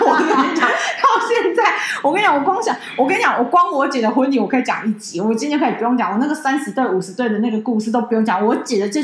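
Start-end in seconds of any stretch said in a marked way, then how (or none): 0.1-3.1 high, laughing through the words; real laughter, words squeezed out high. Real, not acted. Laughing while talking.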